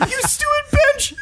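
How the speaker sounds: very high squeaky insult, laughing; squeaky mock insult.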